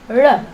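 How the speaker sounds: "R" as an L with tongue back and up; retroflex L. Shown as a demonstration. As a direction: curl the tongue so this L almost sounds like "R". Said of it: "with your tongue back and up".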